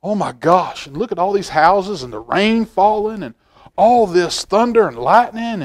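ordinary delivery, nothing marked

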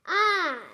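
a sharp, loud, high startled "Ah!"; startled cry. A narrator's reading. Dimpy jumping in fright, a loud startled cry. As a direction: yelp as if jumped at.

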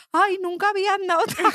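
anciana agotada, voz mucho más aguda; voz de abuela. Retold or imitated. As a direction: imita a la abuela cansada de andar. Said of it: "Y la señora decía". (abuela exhausta, voz aguda imitada)

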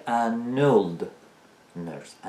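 put-on "an old nurse" very slow, as "a nnnold nurse"; linked n-sound. Shown as a demonstration. Teacher demonstrating n sticking to old, drawn out slowly. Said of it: "ان میچسبه با old".

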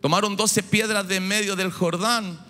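usual speaking voice

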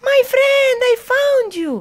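very high, excited goblin cry; high-pitched reunion yell; joyful shout. A narrator's reading. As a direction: shout with relief at finding a friend. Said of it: "shouted Goblin".

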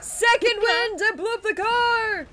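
high, loud excited yell; excited shout. Real, not acted. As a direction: burst out thrilled. Revived mid-fight.

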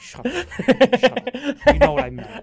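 playful shut up. Real, not acted. After a teasing jab from his cohost, a high laughing shut up. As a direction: laugh it off while telling him to hush. Teased for mixing up fighters.

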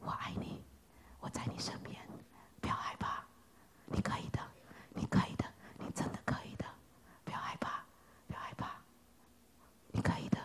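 soft unvoiced reassuring whisper; God's gentle whisper. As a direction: whisper encouragement like a tender father. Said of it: "上帝只是说". (上帝温柔耳语鼓励)

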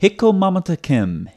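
echoes the mispronunciation mamtakim; mock copy of the quote; imitating his reading. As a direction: repeat Hikko mamtakim as he said it. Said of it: "Let's listen to his quote".

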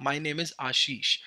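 slow, even, clearly spelled-out name. Shown as a demonstration. Teacher to students, repeating the slow, clear name demo. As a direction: let every syllable of the name land. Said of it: "मैंने अपना नाम बहुत ही slowly और clearly pronounce किया".

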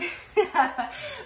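quick high-pitched burst of laughter. Real, not acted. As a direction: let out a bright giggle. Laugh during the reading.

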